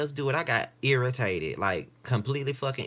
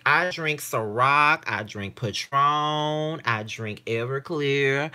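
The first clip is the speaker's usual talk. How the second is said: slow, drawn out, a little louder; slow listing; 4 semitones higher, 4 dB louder, much slower than usual. Real, not acted. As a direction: savour each brand name slowly.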